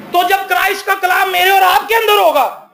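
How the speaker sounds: rising sermon climax, called out to the congregation; preaching shout. Real, not acted. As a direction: lift the voice into a full preaching shout.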